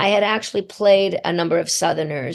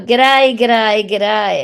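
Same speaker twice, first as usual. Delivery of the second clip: broad Australian g'day; 2 semitones higher, 4 dB louder, much slower than usual. Shown as a demonstration. Demonstrating her childhood Australian accent to the interviewer, in broad Australian. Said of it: "the accent is a very broad".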